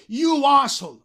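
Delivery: "You asshole" thrown out high and loud; emphatic YOU. Shown as a demonstration. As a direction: hit "You" hard before "asshole". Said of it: "para ponerlo en énfasis". ("You asshole" con énfasis en el "You")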